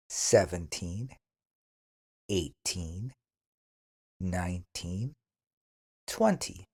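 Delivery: careful counting drill. Shown as a demonstration. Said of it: "Eleven to twenty".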